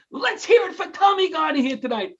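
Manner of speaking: rally the crowd like an emcee; crowd hype shout. Retold or imitated. Host hyping crowd for Tommy.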